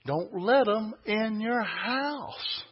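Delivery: high, slow "Don't let 'em in your house."; insistent warning. Real, not acted. Preacher repeats scripture's warning, high and insistent.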